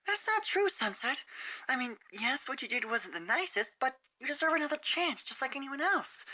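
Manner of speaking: gentle and hesitant, almost apologizing; shy Fluttershy. Acted. Fluttershy voiced.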